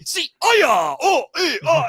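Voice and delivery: high mock kiai shouts; imitating karate yells, loud and high; mock martial arts yells. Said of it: "coach Deadpool on how to make the J D F sounds".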